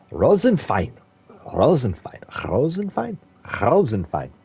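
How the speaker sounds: a streamer repeats Rosenfein in a mock German accent, trying it on. Shown as a demonstration. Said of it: "Pronounce Rosenfeld with the German accent".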